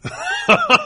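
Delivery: very high, squeaky, laughing Trekkie; teasing laugh. Real, not acted. Host teasing his co-host about being a Trekkie, laughing.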